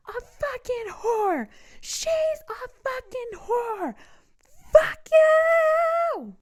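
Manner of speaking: high, slow, drawn-out yell; screaming rage. Retold or imitated. Screaming insults at the man in the car. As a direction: scream it at him with everything.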